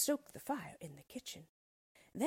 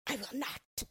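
hissing witch; 6 semitones higher than usual. Acted. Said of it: "hissed the witch".

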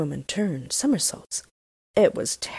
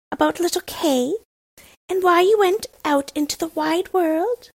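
put-on a lightly raised, bold robber girl voice; brash young robber girl, a touch higher; 10 semitones higher, 5 dB louder than usual. A narrator's reading.

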